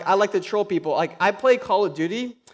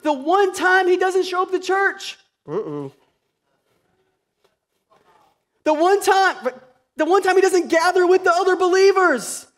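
high, loud passionate preaching; rising sermon fervor; preaching at full force; 11 semitones higher, 5 dB louder than usual. Acted. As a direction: preach it hard to the church. Preaching peak.

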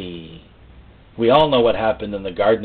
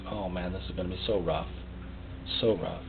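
dreading quietly; 5 semitones lower, 15 dB quieter than usual. Retold or imitated. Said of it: "it was like".

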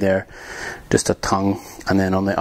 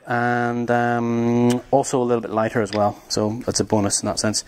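usual speaking voice

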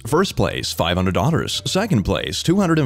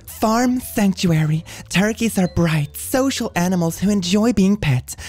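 high mock voice; 10 semitones higher than usual. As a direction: read it in a squeaky voice. Reading post in put-on voice.

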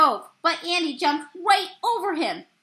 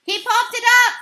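high, loud excited shout; 10 semitones higher, 8 dB louder, faster than usual. A narrator's reading. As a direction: yell it to the whole field. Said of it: "the catcher shouted".

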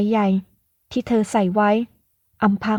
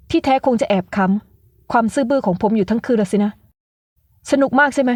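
acted anger. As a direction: throw her words back at her. Angry retort.

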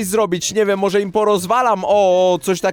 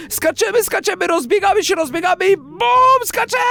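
much higher, thrilled run-up; excited countdown jump; 7 semitones higher than usual. Real, not acted. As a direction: hype the leap. Jumping into the hole.

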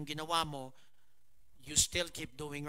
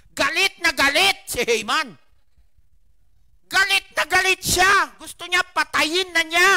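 higher, much louder furious preaching; angry outburst; 13 semitones higher, 17 dB louder than usual. Acted. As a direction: make the rage burst out. Haman's rage, peak of shouting. (galit na sigaw ng mangangaral)

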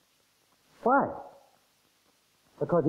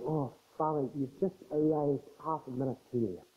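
much softer, fake-sorrowful whine; crocodile tears; 9 dB quieter than usual. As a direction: put on false tears. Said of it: "crocodile tears".

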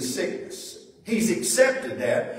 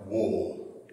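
slow reading; 8 semitones lower, 3 dB quieter, slower than usual. Retold or imitated. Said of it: "But he said".

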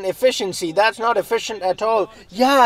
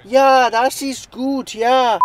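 slow, hammed-up German "das ist gut"; mock German accent; 4 semitones higher, much slower than usual. German character approving the team, slow mock German accent. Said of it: "I'm German".

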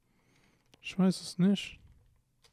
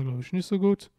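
ordinary talk

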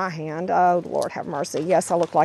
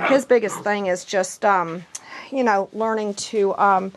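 usual speaking voice

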